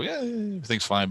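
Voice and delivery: high, soft imitation of Dad; dad's offhand approval. Imitating Dad watching the show, offhand approval. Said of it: "he would kinda look at stuff and go".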